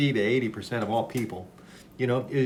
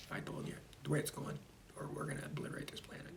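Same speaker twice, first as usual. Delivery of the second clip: whisper; 3 semitones higher, 16 dB quieter, faster than usual. Real, not acted. Dark warning aside.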